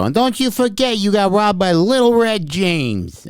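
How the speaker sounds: high, slow bank robber's parting boast; hammed-up high robber voice; Little Red James. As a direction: crow it on the way out, high and stretched. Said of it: "As I'm leaving, I'd go".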